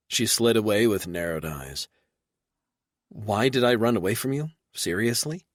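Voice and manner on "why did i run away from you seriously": Seriously? rising high in disbelief; incredulous rising "Seriously?"; voice rising at the end. Acted. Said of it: "Her voice went up at the end".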